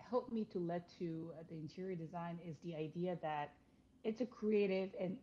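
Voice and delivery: usual speaking voice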